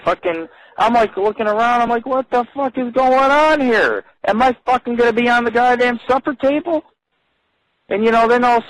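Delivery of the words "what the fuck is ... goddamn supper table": a guy suddenly scared of being dinner; alarmed. Retold or imitated. Alarmed guest at strange party.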